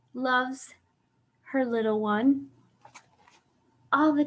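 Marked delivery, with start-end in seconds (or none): none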